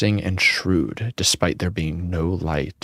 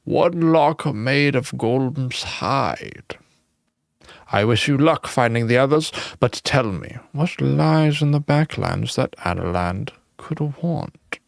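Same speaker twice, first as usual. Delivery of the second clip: ancient ghost, higher; old man; 5 semitones higher than usual. Acted.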